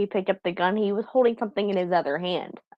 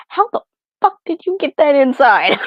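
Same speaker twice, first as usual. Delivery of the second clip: loud, stunned disbelief, pitched higher; incredulous outburst; 6 semitones higher, 8 dB louder than usual. Retold or imitated. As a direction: blurt it out like you can't believe your eyes. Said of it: "I go".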